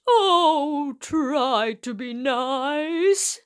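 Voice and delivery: a big lion begging a mouse; whimpering lion. A narrator's reading. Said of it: "he whimpered".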